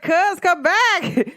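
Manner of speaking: high, slow call through the door. Retold or imitated. Calling her cousin in from outside, drawn-out high call.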